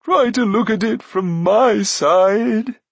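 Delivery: high, loud, slow whine; drawn-out wheedling voice; pleading Glue. A narrator's reading. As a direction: plead for sympathy. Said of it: "said Glue faintly".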